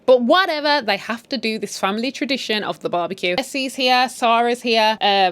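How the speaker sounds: ordinary delivery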